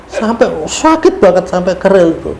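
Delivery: ordinary delivery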